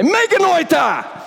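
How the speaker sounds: mock-stern parent's high-pitched exclamation; jokingly over-the-top scolding no to the kids; hammed-up emphatic refusal, pitch leaping high; really strong no. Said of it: "say no really strongly to your kids".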